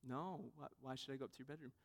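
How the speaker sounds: very soft, low reenacted reply; hushed, offhand dad voice; puzzled father. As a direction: mumble it back like a puzzled dad. Father answers his daughter's odd question.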